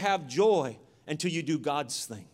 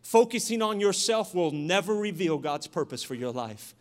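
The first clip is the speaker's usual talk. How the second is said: ordinary talk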